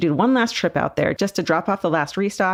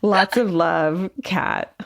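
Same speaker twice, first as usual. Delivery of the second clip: slow drawn-out; much slower than usual. Acted. Sign-off read slowly.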